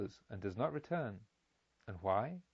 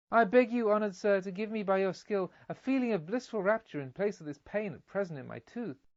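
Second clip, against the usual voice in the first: put-on pleading child; 6 semitones higher, 4 dB louder than usual. A narrator's reading. Spoilt child pleads with physician.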